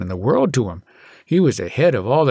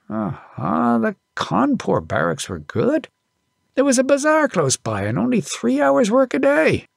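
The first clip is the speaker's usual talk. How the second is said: father reminiscing; 11 semitones higher than usual. A narrator's reading. Father recalling the Cawnpore barracks, lightly voiced. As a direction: linger fondly on the old barracks.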